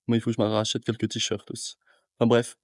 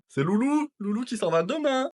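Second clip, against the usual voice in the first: high playful voice; 10 semitones higher than usual. Acted. Playful high-pitched announcing.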